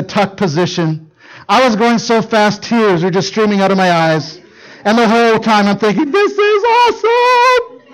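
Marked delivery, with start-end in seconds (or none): 6.1-7.8 much higher, slow, gleeful; thrilled. Retold or imitated. Dad racing down the slope, giddy and much higher. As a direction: savour the speed like a kid.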